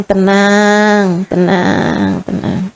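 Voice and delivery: soothing calm. Retold or imitated. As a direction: hush her gently, fading away. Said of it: "Saya bilang".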